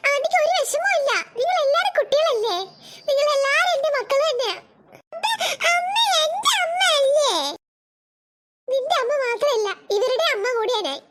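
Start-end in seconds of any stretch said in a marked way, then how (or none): 5.7-7.3 slow, high tearful wail; hurt child crying to her mother. Acted. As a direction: sob it out like a jealous little girl. Jealous child cries to mother.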